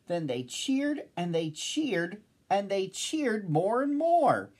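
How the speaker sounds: mounting narrated jubilation; building excitement. A narrator's reading. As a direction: build the cheering with each repeat. Narrator builds with the cheering crowd.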